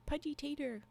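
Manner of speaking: ordinary talk, nothing marked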